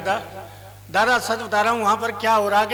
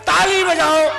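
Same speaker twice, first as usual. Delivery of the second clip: raised, higher-pitched call to the crowd; mock applause command; 6 semitones higher, 4 dB louder than usual. Imagined performer telling the audience to clap, higher mock command.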